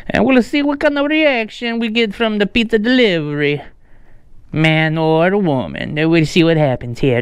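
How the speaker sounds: exaggerated, swooping Italian accent. Prankster telling viewers the pizza's here, mock Italian accent. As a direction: keep up a high, lilting mock Italian accent. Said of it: "uh, an Italian accent".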